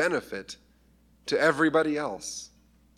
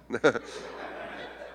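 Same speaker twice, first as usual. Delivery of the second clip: soft laugh; 3 dB quieter than usual. Real, not acted. Laughs after pray for Bethany.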